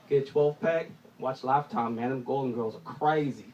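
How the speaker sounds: low, quiet, dead serious advice; lightly voiced serious buddy, low and soft. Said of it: "he was dead serious".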